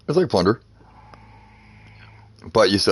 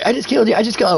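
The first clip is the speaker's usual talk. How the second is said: much higher mocking sing-song; taunting kid; 3 dB louder, faster than usual. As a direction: mimic a bratty kid gloating.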